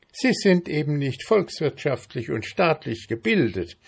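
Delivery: high, condescending ant voice. A narrator's reading. Ant belittling mayfly's education, condescending.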